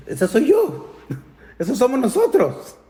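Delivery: high, teary voice with a half laugh; tearful recognition. Retold or imitated. Crying wife recognizing herself in the article, tearful. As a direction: choke it out through tears, amazed.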